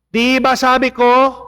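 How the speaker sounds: high, loud scolding shout; furious priest shouting through the door; angry yell. Acted. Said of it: "Sumigaw na naman po yung pare".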